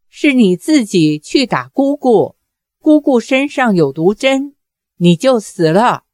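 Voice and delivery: ordinary delivery, nothing marked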